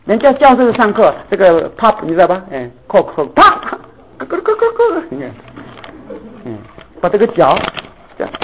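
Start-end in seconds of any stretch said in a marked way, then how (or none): 3.0-5.0 clacking noises. Retold or imitated. Imitating student's clacking noises.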